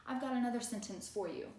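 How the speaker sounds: high, bright classroom voice; teacher voice. Shown as a demonstration. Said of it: "role-plays teaching the class".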